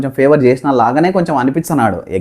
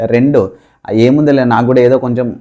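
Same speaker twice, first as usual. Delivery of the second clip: ordinary talk, nothing marked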